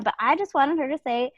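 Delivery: usual speaking voice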